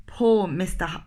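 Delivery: ordinary delivery, nothing marked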